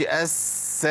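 "أس" with an exaggerated, drawn-out hiss; slow, stretched hiss on "أس"; overlong seen. Shown as a demonstration. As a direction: hold the s of "أس" too long. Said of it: "ما قلتش".

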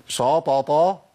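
شططا with a heavy, dark sha; thickened shin. Shown as a demonstration. Teacher showing the mistake, heavy shin in شططا. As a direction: darken the first syllable of شططا. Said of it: "هو تفخيم".

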